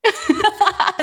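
high burst of laughter; laughing. Real, not acted. Laughs at own ad voice.

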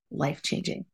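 breathed out with no voice; hushed. Real, not acted. Telling listeners how CrossFit changed her life, in a hushed whisper. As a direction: let the word drop to a whisper. Emotional weight of CrossFit memory.

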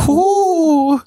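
very high, loud ghostly wail; Paul Bearer. Acted. Host showing off a wrestling manager, high wailing impression. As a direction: wail it out in a spooky falsetto. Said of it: "Paul Bearer?".

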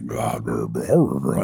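deep rumbling drone; natural low voice. Shown as a demonstration. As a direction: drop to his real low register. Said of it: "the real one is".

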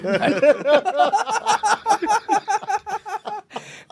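long high-pitched laugh. Real, not acted. Laughing at the bit.

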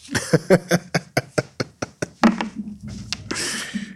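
breathy chuckle; breathy laugh. Real, not acted. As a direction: laugh it off lightly. Laughing at own frustration.

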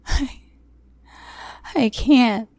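high, halting plea; begging. A narrator's reading. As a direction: stammer out a desperate refusal. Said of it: "I begged".

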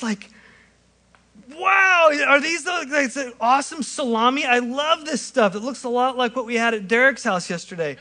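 high, squealing delight over snacks; hammed-up excitement at a free spread; busy believer gushing over the food, high and excited. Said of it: "it would go something like this".

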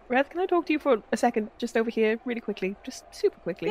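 quiet aside. Acted. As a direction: hush it as a private word.

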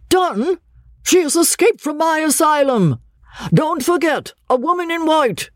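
very high, emphatic exclamation; agitated pursuer. A narrator's reading. Asylum man answering the policeman's question, agitated. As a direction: exclaim it urgently, voice pitched right up.

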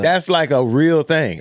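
normal talking voice

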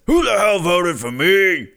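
loud, slow Rupert Boneham growl; Rupert impression. Doing Rupert for his co-host, loud and slow. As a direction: belt it out like Rupert in his confessional. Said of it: "That's a great voice, [name]".

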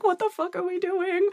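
high-pitched panicked squeak; mock panic. [name] at the boardroom table, mock panicked and high. Panicking while signing papers.

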